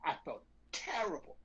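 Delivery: soft, remorseful retelling; on the verge of tears. Retold or imitated. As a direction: let the voice drop away with guilt. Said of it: "on the verge of tears".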